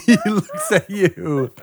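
high, loud words squeezed through laughter; laughing narration. Real, not acted. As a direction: narrate while cracking up. GM cracking up at request.

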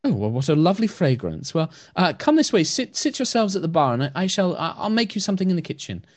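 high, soft, hesitant elf calling out; soft-voiced elf woman. Blind wood elf calls out about smashing, soft high voice. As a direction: call out gently, unsure what broke. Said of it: "kindly sort of turns around".

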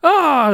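high, loud "ah"; drawn-out high wail; loud high cry. Acted. GM voices a character crying out.

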